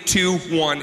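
usual speaking voice

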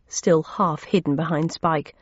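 plain reading voice

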